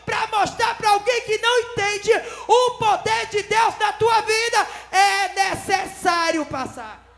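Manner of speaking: loud, high sermon climax; fiery preaching. Acted. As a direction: preach it out with full force. Preaching at full force. (pregação inflamada)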